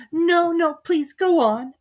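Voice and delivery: high, slow pleading Alice; humble Alice. A narrator's reading. Alice humbly begging the Dormouse, humble. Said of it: "Alice said very humbly".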